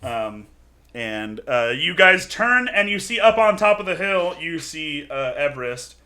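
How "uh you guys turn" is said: ordinary talk, nothing marked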